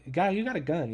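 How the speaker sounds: ordinary talk, nothing marked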